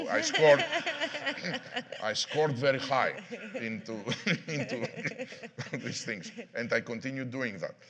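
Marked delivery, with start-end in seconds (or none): 0.1-6.0 laughing through the words, loud bursts. Real, not acted. CEO laughing at his own Greek accent joke, laughing. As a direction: chuckle as you admit it.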